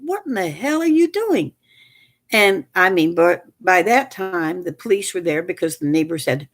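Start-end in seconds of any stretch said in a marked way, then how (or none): 0.0-1.5 high, startled disbelief; stunned high-pitched demand; incredulous shout. Retold or imitated. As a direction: throw it out in shock. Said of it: "Derek said".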